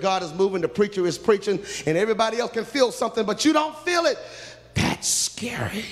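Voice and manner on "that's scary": hushed, breathy whisper, drawn out slowly; whispered warning. Acted. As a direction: let it fall to a scared whisper. Preacher stresses fear of hardness.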